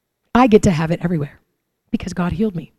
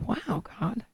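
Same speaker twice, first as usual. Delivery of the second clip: awed whisper; 14 dB quieter than usual. Retold or imitated. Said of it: "And I'm like".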